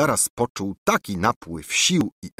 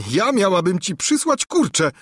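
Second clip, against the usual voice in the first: laughing scornfully; 13 semitones higher, 4 dB louder than usual. A narrator's reading. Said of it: "zaśmiała się księżniczka".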